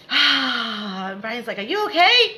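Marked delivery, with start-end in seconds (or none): 0.1-1.1 big noisy "ah" exhale; loud breath. Shown as a demonstration. Showing how she usually breathes, big loud exhale. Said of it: "I usually take a breath and like". 1.6-2.4 high, loud startled question; mock alarm. Brian reacting to her noisy breathing, mock alarm.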